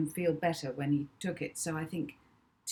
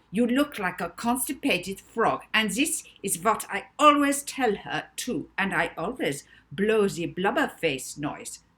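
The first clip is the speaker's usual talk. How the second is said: louder blurting insults, lightly voiced; babbling Notman; 3 semitones higher, 6 dB louder than usual. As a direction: blurt it out helplessly. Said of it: "he started to babble".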